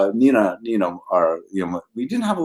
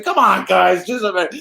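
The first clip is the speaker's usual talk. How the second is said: put-on old Carl Reiner; 8 semitones higher, 3 dB louder than usual. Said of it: "Carl Reiner voiced in story".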